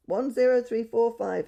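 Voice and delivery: high, loud bird-like call; budgie calling. A narrator's reading. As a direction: chirp the number like a parrot. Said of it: "he called".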